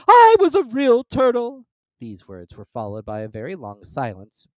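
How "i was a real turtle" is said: slow, loud, high wail; hammed-up sobbing turtle; mournful sigh. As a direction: moan it out tragically. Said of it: "with a deep sigh".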